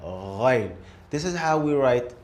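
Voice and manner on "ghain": 'Ghain' with a gargled uvular gh; Arabic letter Ghain. Shown as a demonstration. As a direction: rasp the gh at the back of the throat. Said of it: "Now let's move to Ghain".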